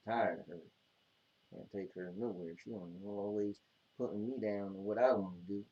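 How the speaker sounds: very low, hushed muttering; grumbling to himself. Real, not acted. Man complaining to himself about his co-host, muttered grumble. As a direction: grumble under your breath, barely audible.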